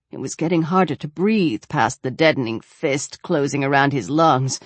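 plain reading voice

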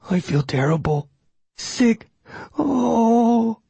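high, queasy hound groaning; a dog about to vomit; sick dog. A narrator's reading. Hound feels sick after blood.

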